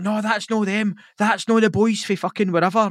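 high-pitched shouted retelling of a gang member; mock thug call. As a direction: call it out like a riled-up lad.

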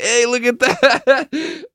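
high, drawn-out laughing call; cracking-up friend. Retold or imitated. Zach shows Mom the note, high laughing call. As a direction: imitate someone laughing too hard to talk. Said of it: "Zach just looks up and just goes".

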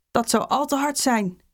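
plain reading voice

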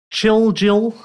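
drawn-out, high-pitched "Chill Jill"; careful name reading. Real, not acted. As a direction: sound out "Chill Jill" deliberately, word by word. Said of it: "I haven't got a speech impediment".

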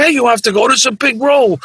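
a lightly voiced agent talking him into it; pushy agent.

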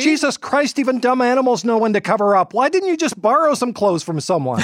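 high, exasperated scolding. Acted. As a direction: berate him in disbelief. Anchor berating reporter.

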